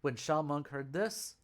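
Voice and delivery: ordinary delivery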